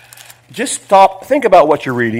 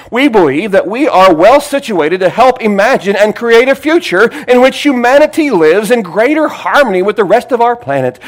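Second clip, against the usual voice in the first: higher, louder, rolling oratory that sinks at the end; dramatic reading; 6 semitones higher, 5 dB louder than usual. Acted. Preacher reading the pagan manifesto to his church with swelling oratory. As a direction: build each we are line, then hush. Reading statement with mounting rhetoric.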